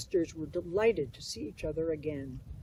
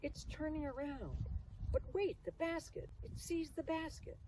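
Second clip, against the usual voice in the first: much higher, urgent rising voice; excited cry; 10 semitones higher, 8 dB quieter than usual. Acted. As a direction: gasp it out as the swimmer turns. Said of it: "cried Chloe".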